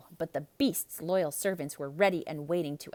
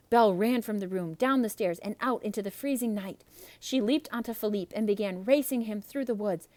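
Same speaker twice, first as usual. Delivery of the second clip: usual speaking voice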